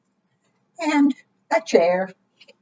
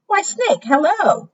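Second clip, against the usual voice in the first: high, singsong mouse voice; mouse's friendly greeting; 9 semitones higher than usual. Acted.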